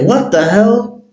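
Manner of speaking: shocked high-pitched what the hell, drawn out; stunned squeal, slowed right down; bugging out. Acted. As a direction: leap way up in disbelief. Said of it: "As he bugs out".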